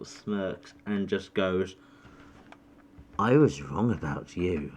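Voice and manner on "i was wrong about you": smirking. Acted. GM voices smirking drow.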